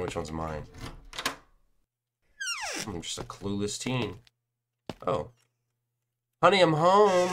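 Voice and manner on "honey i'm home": calling out. Pretend. Arrives home in game, calls out.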